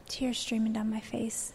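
soft, slow, voice dropping to a whisper; hushed near-tearful whisper; breaking whisper. Real, not acted. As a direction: barely get the words out, holding back tears. Recalling tears at the ultrasound.

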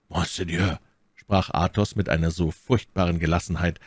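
ordinary delivery

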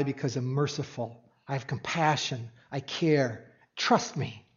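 tender reassurance. Acted. Jesus voiced, softly reassuring.

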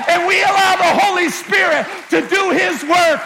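rising, shouting preacher's build; full-voiced pulpit preaching; fiery preaching. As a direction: preach it to the congregation.

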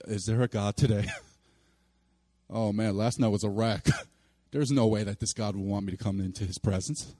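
low, deflated morning-after voice; weary doubter. Acted. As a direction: mumble it softly like someone waking up defeated.

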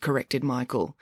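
usual speaking voice

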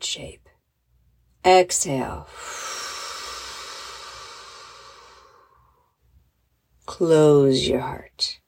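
slow, drawn-out breathing cues; slowly. Shown as a demonstration. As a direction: stretch each cue out with long pauses. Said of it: "but more slowly".

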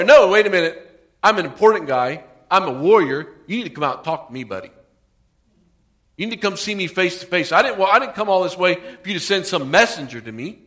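loud, offended, self-important; a proud warrior loudly demanding the prophet come out; proud anger. Retold or imitated. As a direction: puff up and demand respect, loud. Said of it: "Naaman went away angry".

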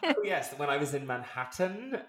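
"Manhattan" with every T carefully sounded; over pronunciation. Shown as a demonstration. Showing her old habit, "Manhattan" grandly overpronounced. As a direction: start high and over-articulate "Manhattan". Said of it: "it was just this over pronunciation".

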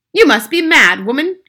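high, loud outburst; angry cry. A narrator's reading. Father who disbelieves grandmother, loud angry cry. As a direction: cry out in exasperation. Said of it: "cried Mister Jenkins".

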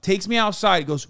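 ordinary talk

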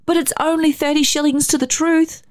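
put-on very high, tearful child's plea; small child on the edge of tears; near tears. A narrator's reading. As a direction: plead as a boy close to crying. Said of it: "very near to tears".

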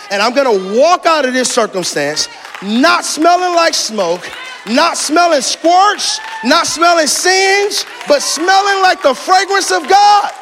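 high, shouted declarations; ringing pulpit proclamation; full-force preaching. Real, not acted. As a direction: preach it triumphantly, stretching key words.

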